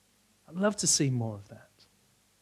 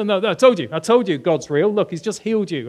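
louder, higher gloating I-told-you-so; triumphant cry; 4 semitones higher, 7 dB louder than usual. Retold or imitated. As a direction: rub the proof in with a mock shout.

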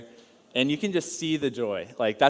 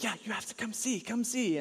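put-on eager child; 10 semitones higher, 6 dB quieter than usual. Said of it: "and he's like".

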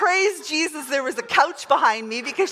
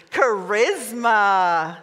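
slow, teasing "Charisma." drawn out; flirty "Charisma." stretched long; kind of flirty; 4 semitones lower, 4 dB louder, much slower than usual. Shown as a demonstration. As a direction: purr "Charisma." flirtatiously, very slowly. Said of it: "Say it, like, kind of flirty".

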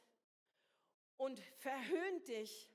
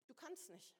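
hushed put-down; 12 dB quieter than usual. Retold or imitated.

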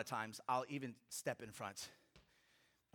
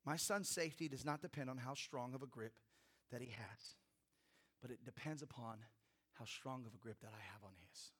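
soft repeat; 3 semitones lower, 7 dB quieter than usual. Acted. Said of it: "I want you guys to understand this".

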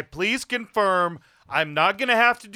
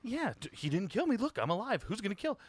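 hushed conspiratorial whisper; 7 dB quieter, faster than usual. Acted. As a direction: scheme quietly out of earshot. Whispered scheming aside.

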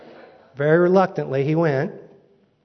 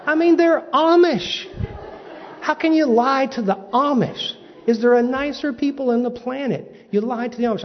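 aghast, laughing at the audacity; incredulous outburst; 8 semitones higher than usual. Retold or imitated. Friend aghast at the lie.

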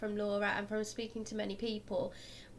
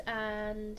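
ordinary delivery

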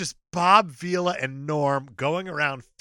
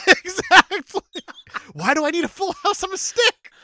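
high stick puppet voice; 11 semitones higher, 5 dB louder than usual. Acted.

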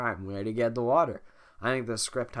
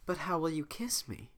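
whispered princess; 5 semitones higher than usual. Acted. Voicing Fiona.

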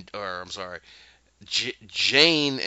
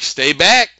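warning shout; 8 semitones higher, 13 dB louder than usual. Retold or imitated. Girl warns approaching man.

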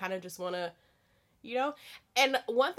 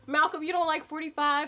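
incredulous; 6 semitones higher, 7 dB louder than usual. Real, not acted. Teasing disbelief at Malcolm.

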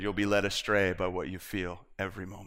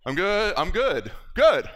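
higher, louder, clipped nervous answer; harsh nervous blurt; 8 semitones higher, 6 dB louder, slower than usual. Retold or imitated. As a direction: blurt it out too hard while panicking. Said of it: "That was harsh".

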